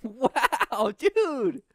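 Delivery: high, slow excited ad read; ad announcer voice. Pretend. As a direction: sell it like a TV ad. Mock advertising cheer.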